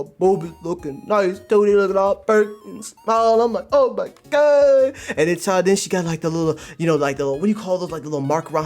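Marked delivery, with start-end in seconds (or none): 3.7-5.0 high, loud, drawn-out exclamation; hyped astonishment. Retold or imitated. Reaction to panels.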